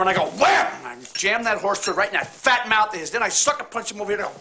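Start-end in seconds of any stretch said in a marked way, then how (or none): 0.4-0.7 punchy shouted "wham" sound effect; loud "wham" effect. Retold or imitated. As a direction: bang out the hit like a comic-book sound. Sound effect while retelling a fight.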